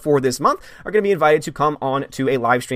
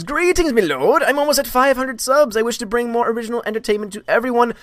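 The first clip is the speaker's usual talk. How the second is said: high put-on herald voice; mock courtly servant; 9 semitones higher than usual. Reading chat as servant voice.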